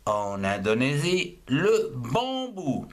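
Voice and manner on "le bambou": slow, careful modelling. Shown as a demonstration. Teacher introduces word slowly.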